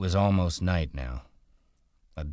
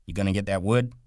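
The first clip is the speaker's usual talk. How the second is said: demanding; 5 semitones higher, 4 dB louder, faster than usual. A narrator's reading. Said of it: "George demanded".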